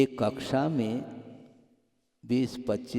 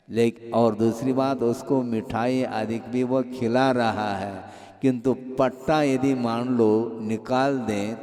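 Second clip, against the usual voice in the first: usual speaking voice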